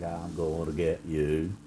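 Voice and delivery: a hint of a comic villain; mock menace. Mocking the man grabbing the bleach, slow mock menace. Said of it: "and he's like".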